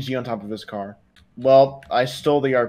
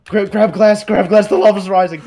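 louder, higher panicked calls; panicked shouting; 6 semitones higher, 9 dB louder than usual. Real, not acted. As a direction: shout it as the lava rises. Lava rising in game.

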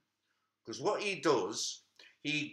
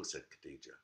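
usual speaking voice

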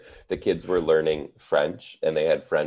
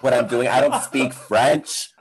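exasperated retort; 6 semitones higher, 4 dB louder than usual. Retold or imitated. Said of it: "I'm like".

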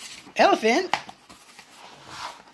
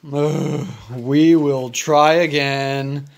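Gerald voice; 5 semitones lower than usual. Acted. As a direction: lower big elephant voice. Gerald echoes Piggy.

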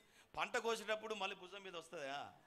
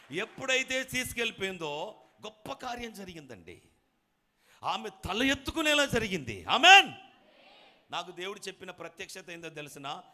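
climactic preaching; 3 semitones higher, 17 dB louder than usual. Acted. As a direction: build to a roaring hallelujah. Preacher builds to climax of miracle.

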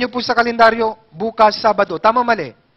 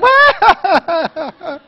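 imitated crying; 7 semitones higher, 7 dB louder than usual. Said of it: "ganun pa yung iyak niya".

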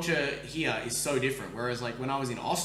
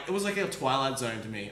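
usual speaking voice

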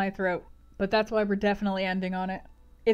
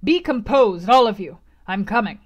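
loud command to calm down; shouted reassurance; 9 dB louder than usual. Acted. Host quiets his alarmed guests, loud commanding call. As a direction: take charge over a noisy crowd. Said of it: "was shouted in return".

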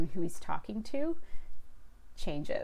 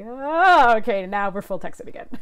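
delighted mock reaction; 4 semitones higher, 10 dB louder than usual.

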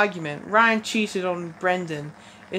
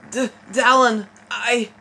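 higher, louder halting cry of a name; acted lover losing the words; overcome stammer; 4 semitones higher, 6 dB louder than usual. Acted lover mid love scene.

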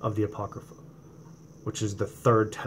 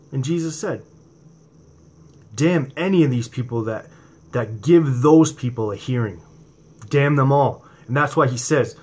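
louder, impassioned preacher voice that drives each question home; fiery preaching; 3 semitones higher, 5 dB louder than usual. Acted. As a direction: preach it at full force to the congregation. Preaching to viewers about testing.

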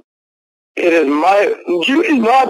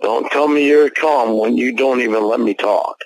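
calming down; 7 semitones lower than usual. Real, not acted. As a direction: bring it down, trying to calm. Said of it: "we both have to calm down".

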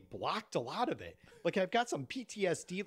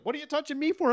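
mock dad; 9 semitones higher, 5 dB louder, faster than usual. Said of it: "He's like".

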